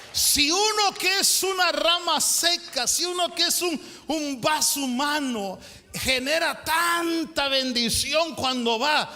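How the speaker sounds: loud, high pulpit shout; fiery preacher rising to a climax; preaching at full force. Acted. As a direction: drive the point home to the congregation.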